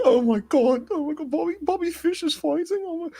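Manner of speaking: high-pitched, excited put-on accent; hammed-up accent, excited and high; Charlie impression, accent. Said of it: "Such bad accent".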